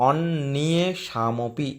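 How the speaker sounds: অন্যেষামপি split slowly into parts; broken into syllables. Shown as a demonstration. Teacher breaking the word apart for students, slow. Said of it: "ভেঙে দিচ্ছি".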